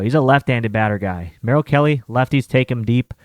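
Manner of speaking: normal talking voice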